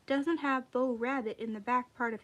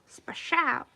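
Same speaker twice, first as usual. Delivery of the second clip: splash noise; 3 semitones higher, 4 dB louder than usual. A narrator's reading. Whale tail splash noise.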